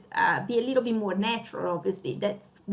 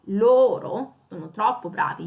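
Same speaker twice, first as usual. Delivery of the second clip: loro punched louder; stress on loro; 5 dB louder than usual. Shown as a demonstration. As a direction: hit the loro hard. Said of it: "You wanna really stress out that loro".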